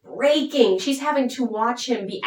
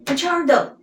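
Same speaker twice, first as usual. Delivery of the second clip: higher, insistent retold plea; upset reveal; 7 semitones higher, 4 dB louder than usual. Upset fiancée reveals herself to him, high insistent plea. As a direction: act out urgently setting someone straight. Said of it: "she's so upset by this".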